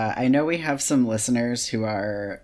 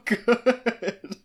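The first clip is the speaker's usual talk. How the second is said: higher, slow, laughing through the words; giggling agreement, much higher and drawn out; 13 semitones higher, much slower than usual. Real, not acted. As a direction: laugh it out in delight. Echoing co-host, laughing.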